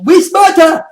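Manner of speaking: loud, high caveman boast; hammed-up dim-witted brag; mock dumb voice. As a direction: shout it like a proud idiot. Mocking dumb internet arguers.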